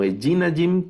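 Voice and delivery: ejinajim stressed early, on -JI-; wrong stress. Shown as a demonstration. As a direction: misplace the stress onto -ji- in ejinajim. (ejinajim mal acentuado, en la segunda sílaba)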